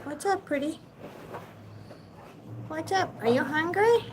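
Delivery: high-pitched cooing to a pet; pet talk. Real, not acted. Owner greeting a pet reptile and asking if it's hungry, high pet talk. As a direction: coo sweetly up high to an animal. Talking to her pet snake.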